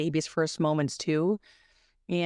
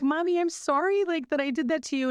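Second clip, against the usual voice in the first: son's voice; 10 semitones higher than usual. Retold or imitated. A son says sorry to his mother, in a higher child's voice. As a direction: imitate a small child apologizing. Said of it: "he was like".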